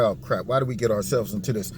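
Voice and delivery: mock inner groan; imitating CNN's dismay. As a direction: mutter it under your breath like a worried host. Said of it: "They're like".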